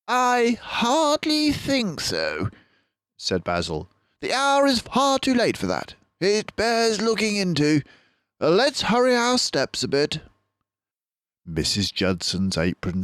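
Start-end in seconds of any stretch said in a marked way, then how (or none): 0.1-2.5 high, slow, doubtful detective; detective voice, slow and doubting; Basil, grave. A narrator's reading. 4.2-10.2 higher detective voice, urging; a firm call to hurry; detective urging his friend along; Basil, brisk. A narrator's reading. Basil urges haste.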